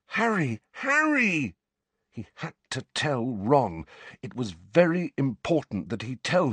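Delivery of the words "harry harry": high-pitched repeated cry, rising in urgency; urgent calling. Acted. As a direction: call out to rouse him. Ron calls to Harry again.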